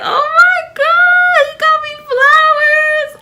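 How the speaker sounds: very high, loud, drawn-out squeal; overjoyed squeal. Acted. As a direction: shriek it slowly in pure delight. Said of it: "I was so happy".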